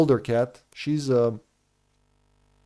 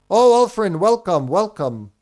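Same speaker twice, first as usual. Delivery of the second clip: excited greeting; 6 semitones higher, 4 dB louder than usual. Real, not acted. Greeting a chat viewer.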